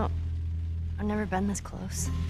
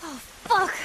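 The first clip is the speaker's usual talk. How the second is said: loud, higher, rattled exclamation; shocked outburst; 5 semitones higher, 6 dB louder than usual. Acted. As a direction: blurt it out in raised-voice shock. Shock after the guards are attacked.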